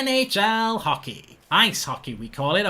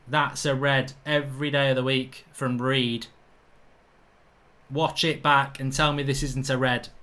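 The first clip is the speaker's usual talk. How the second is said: normal talking voice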